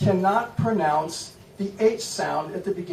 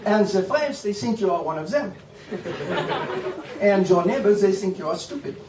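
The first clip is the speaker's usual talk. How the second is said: higher, hammed-up French accent held through; mock French accent; 5 semitones higher than usual. Shown as a demonstration. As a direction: keep up the silly French accent. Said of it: "you simply speak like this".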